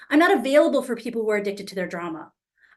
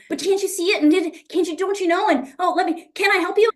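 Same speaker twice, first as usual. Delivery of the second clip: high, insistent, pleading pushiness; righteous pushing; 7 semitones higher, 3 dB louder than usual. Retold or imitated. Pushing her thoughts on someone who won't listen, high righteous insistence. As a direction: overpower them, desperate to make them see. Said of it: "I'd go into righteousness".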